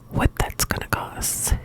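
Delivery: ordinary delivery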